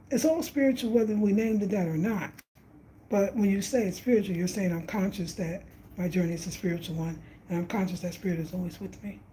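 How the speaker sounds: ordinary talk, nothing marked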